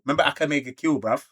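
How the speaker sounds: ordinary delivery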